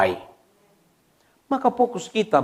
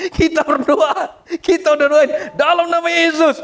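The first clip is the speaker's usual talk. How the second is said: high, raised deliverance prayer; forceful prayer; 10 semitones higher, 4 dB louder than usual. Retold or imitated. Pastors praying over supposedly possessed person, high forceful prayer. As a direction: re-enact praying hard over someone.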